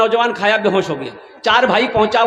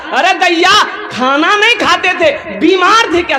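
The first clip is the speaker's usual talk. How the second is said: higher, startled cry of alarm; shocked brothers; 6 semitones higher, 3 dB louder than usual. Acted. Brothers shocked seeing their sister, startled higher cry. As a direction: gasp at how thin she got.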